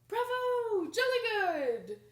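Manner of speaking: high, loud cheering British crowd. Acted. Royal audience applauding Annette, loud cheers. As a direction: cheer like an audience gone wild.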